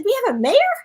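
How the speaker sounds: high, loud astonished question; amazed. Retold or imitated. Retelling her shock to the post gal, high and astonished. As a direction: disbelief at having a mayor.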